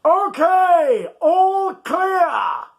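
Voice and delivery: high, loud cat call; cheeky cat captain calling out; Alfonso cries out. Acted. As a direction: shout the all clear to the hidden crew. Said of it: "the voice of Alfonso cried".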